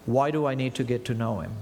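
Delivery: ordinary delivery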